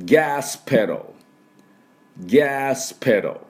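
「ガスペダル」を高めに張って「ギャースペロー」; 「ガス」を長く「ギャース」、続けて「ペロー」; 復唱用の発音手本. Shown as a demonstration. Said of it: "私の後で発音いきましょう". (生徒が後に続くよう「ガスペダル」を米国式に「ギャースペロー」)

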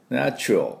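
ナチュラル as "nyah-chrol"; ナチュラル in English, short reduced ending; English "ae" vowel. Shown as a demonstration. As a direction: open ナチュラル with a wide meow-like "nae". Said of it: "ねのところをミャーの音で".

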